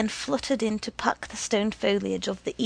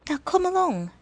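brisk urging; 9 semitones higher than usual. A narrator's reading. Woman urging boy.

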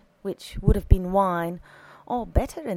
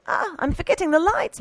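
high, louder exclamation; flustered housekeeper; 10 semitones higher, 5 dB louder than usual. A narrator's reading. Housekeeper remembering the lights, flustered. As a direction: exclaim it, pitched well up.

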